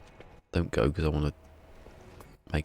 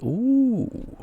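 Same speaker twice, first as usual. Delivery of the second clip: surprised ooh; 4 dB louder than usual. Real, not acted. Sees the Switch price.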